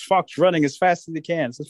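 ordinary delivery, nothing marked

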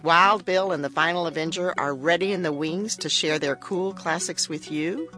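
usual speaking voice

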